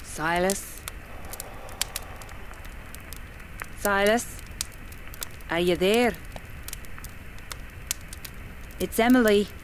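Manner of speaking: softly calling out into the dark; calling a spirit. Acted. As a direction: coax the guide to answer. Medium calls her spirit guide.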